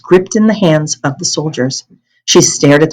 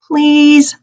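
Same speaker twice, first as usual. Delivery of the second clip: high, begging "Please"; pleading; 10 semitones higher than usual. Acted. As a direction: beg her sweetly to come. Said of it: "Anne Marie pleaded".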